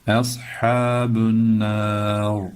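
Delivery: "Ashabun nar" with a heavy sad and a thick final r, sung slowly; thin e, thick sad. Shown as a demonstration. Teacher models "Ashabun nar", slow sung. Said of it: "e ince, sad kalın".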